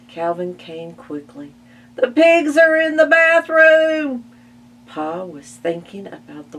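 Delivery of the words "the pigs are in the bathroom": high, loud panicked cry; alarmed shout. Acted. Ma shouting to Calvin about the pigs, high loud. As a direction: yell it in alarm.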